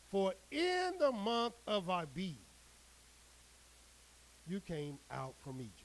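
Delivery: measured, declaiming scripture read; solemn preacher reading the Word. Acted. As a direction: proclaim the verse to the flock. Reads scripture aloud to congregation.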